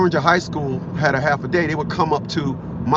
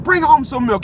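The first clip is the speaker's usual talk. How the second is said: higher, louder nagging wife voice; mock wife's order; 10 semitones higher, 4 dB louder than usual. Wife ordering husband to bring milk, higher, louder nagging voice. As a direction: play the wife bossing him, pitched up.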